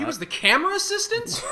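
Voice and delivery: high-pitched disbelief; incredulous question. Real, not acted. Co-host hears the director's lowly credit, incredulous.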